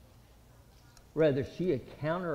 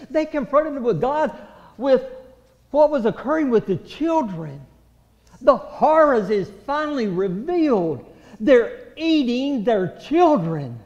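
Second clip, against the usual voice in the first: loud, ringing altar-call preaching; full-force preaching; 7 semitones higher, 8 dB louder than usual. Acted. Preacher urging the congregation to plead, at full force. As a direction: press the congregation hard to pray.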